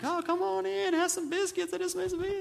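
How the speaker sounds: put-on high, sing-song Southern accent; Southern hospitality voice. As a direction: chirp it like a sweet Southern host. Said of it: "they're like".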